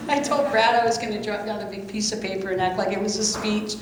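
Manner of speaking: laughing through the words at her own nerves; nervous giggle breaking up the sentence; embarrassed laughter. Real, not acted. Speaker at the podium laughing at her own stage fright, giggling.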